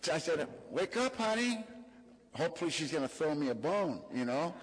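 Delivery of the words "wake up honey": tender sing-song, higher; gentle wake-up. Retold or imitated. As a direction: sweetly rouse a sleeping child. Gently waking his daughter.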